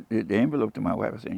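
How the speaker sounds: ordinary talk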